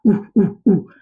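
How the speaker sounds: high loud monkey hoots. Acted. Monkeys hoot as they swing away, high and loud.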